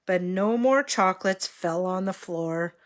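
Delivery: usual speaking voice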